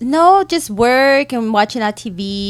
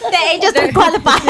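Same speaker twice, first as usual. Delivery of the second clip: ordinary talk, nothing marked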